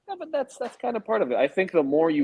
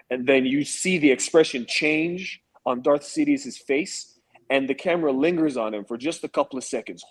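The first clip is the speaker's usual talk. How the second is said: ordinary talk, nothing marked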